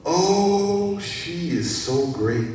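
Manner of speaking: long high "oh", then admiring; gushing praise. Acted. Preacher quoting praise of a woman, gushing. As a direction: draw out the praise, starting high.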